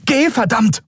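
high-pitched battle yell; urgent command. A narrator's reading. Andriel ordering Kai to go, urgent shout. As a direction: shout over the firefight.

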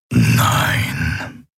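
low, very slow imperious voice; menacing emperor. Acted. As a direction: draw out each word as a looming dark emperor. Kaiser setzt die Folter fort. (bedrohlicher Kaiser, tief und sehr langsam)

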